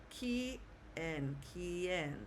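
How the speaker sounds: "quien" as kee, pause, en, then joined kee-en; syllable-by-syllable "quien", U silent; broken into syllables. Shown as a demonstration. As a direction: say "quien" piece by piece, then whole. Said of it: "just break it into syllables".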